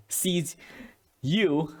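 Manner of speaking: normal talking voice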